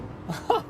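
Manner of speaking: high breathless laugh of disbelief; high giddy laugh. Real, not acted. Streamer overwhelmed by a viewer's gift, high giddy laugh.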